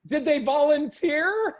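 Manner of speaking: high, slow, mock-naive voice; mocking clueless question. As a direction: play the teacher as painfully clueless, drawn out. Imitating teacher's clueless question.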